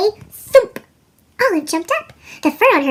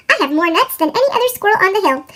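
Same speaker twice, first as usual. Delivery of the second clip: talking fast; 3 semitones higher, faster than usual. Acted. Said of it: "The squirrel could talk really fast".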